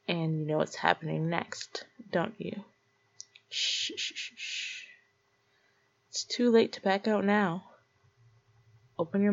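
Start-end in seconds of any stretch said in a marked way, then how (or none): 3.5-4.8 whispered shushing, fading softer. Acted. Calming the nervous listener with soft shushing. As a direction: hush him gently.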